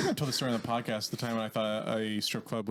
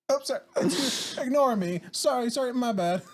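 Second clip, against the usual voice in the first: high, hammed-up little apologies; overly apologetic; 11 semitones higher, slower than usual. Shopper interrupting a Walmart fight, overly apologetic. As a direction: excuse yourself in a high, fussy voice. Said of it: "Just being overly apologetic for no reason".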